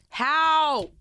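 ordinary talk

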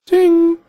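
high, loud sung ding; bell ding. Pretend. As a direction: chime like a prize bell. Imitating a bell for the winner.

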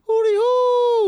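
high, slow owl call; owl hoot. Pretend. Mimicking owls in the neighborhood, high slow hoot.

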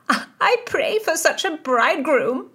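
high, loud excited squeal; shrieking. A narrator's reading. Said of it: "shrieked Mary Howard".

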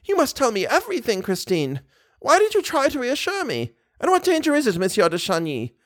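put-on very high, agitated old lady voice; frightened old mother. Old lady questions daughter and visitor, high and alarmed. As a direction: plead for answers in a high old voice.

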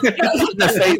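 high, laughing through the words; laughing disbelief. Retold or imitated. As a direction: giggle it out incredulously.

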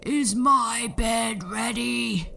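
slow, loud giant growl. A narrator's reading. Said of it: "the giant would growl".

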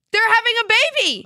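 high fast excited reveal. Real, not acted. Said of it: "news of a fan's baby".

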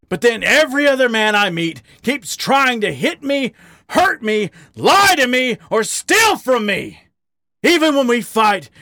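anguished outburst. A narrator's reading. Harry telling his lover why it hurts.

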